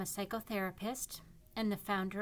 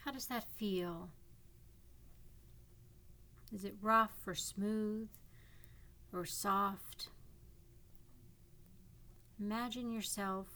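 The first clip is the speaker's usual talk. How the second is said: calm guiding voice with long pauses; guided meditation. Acted. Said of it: "This is a guided imagery meditation".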